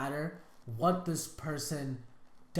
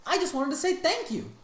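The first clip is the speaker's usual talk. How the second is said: mocking quote; 9 semitones higher than usual. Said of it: "here they're like".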